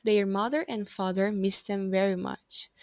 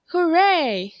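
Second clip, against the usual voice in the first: put-on loud, high cheering kids; joyful shout; 8 semitones higher, 11 dB louder than usual. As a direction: cheer it out with joy. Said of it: "shouted the boys".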